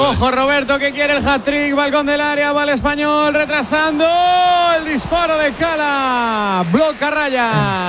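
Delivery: rising radio match call, slow shouted finish; live play-by-play. Real, not acted. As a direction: build up the attack, then shout the shot. Narración de una jugada en directo. (narración en directo que sube hasta el disparo)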